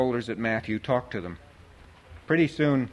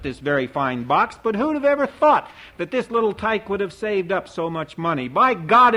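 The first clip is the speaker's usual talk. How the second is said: much higher, louder auctioneer cry; auctioneer's call; 8 semitones higher, 5 dB louder than usual. A narrator's reading. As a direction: sing out the bid like an auctioneer. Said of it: "running it out".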